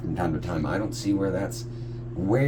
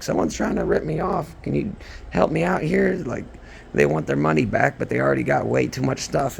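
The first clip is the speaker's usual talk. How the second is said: higher-pitched imitation of a worked-up mom; imitated pleading mom; 8 semitones higher than usual. Son retelling mom waking him for help, imitated higher pleading voice. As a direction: retell mom's plea in a raised pitch.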